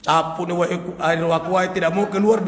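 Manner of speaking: ordinary talk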